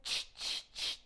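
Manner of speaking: unvoiced ch ch ch; monkey chatter. A narrator's reading. Monkeys mock the peddler, soft unvoiced chatter. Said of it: "monkeys ... said".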